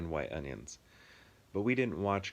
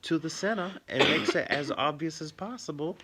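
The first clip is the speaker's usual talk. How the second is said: put-on Southern accent; 9 semitones higher, 4 dB louder than usual. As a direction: mimic a Southern character, pitched well up. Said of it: "you're doing that as a Southern accent".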